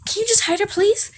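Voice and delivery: high, loud acted begging; exasperated plea. Hanji pleads with Aaron.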